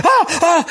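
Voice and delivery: high, startled yelps; retold shriek. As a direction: squeal at the sight of it. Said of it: "you're immediately like".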